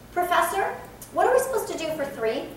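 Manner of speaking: Professor, lifted high and respectful, quick; polite address. Pretend. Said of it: "Totally different".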